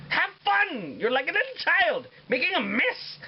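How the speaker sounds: high, bright-pitched teasing cheer to the viewer; playful cheer. Real, not acted. Cooking host cheering viewers making a mess, high playful cheer.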